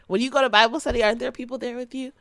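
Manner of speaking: ordinary talk, nothing marked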